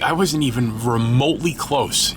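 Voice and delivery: ordinary delivery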